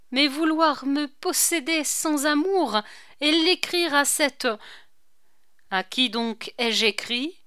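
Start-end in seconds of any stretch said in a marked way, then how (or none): 0.1-4.5 indignant. A narrator's reading. Marie accuses the marquis.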